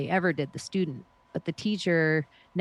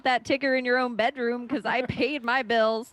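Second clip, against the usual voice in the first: sarcastic fake apology; 6 semitones higher than usual. Mocking the government over debt.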